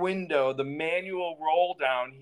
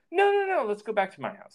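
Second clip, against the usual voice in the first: insistent Steve; 7 semitones higher, much faster than usual. Retold or imitated. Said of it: "Steve says".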